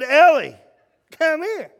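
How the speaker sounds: calling out. Retold or imitated. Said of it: "I said".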